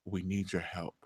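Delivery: very soft, low admission; hushed, grudging request for help; reluctant plea. Retold or imitated. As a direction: barely say it, voice dropped right down.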